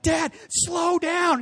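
high, slow, pleading shout; frightened ten-year-old crying out; scared kid yelling. Retold or imitated. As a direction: beg dad to slow down.

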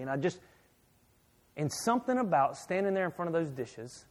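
ordinary talk, nothing marked

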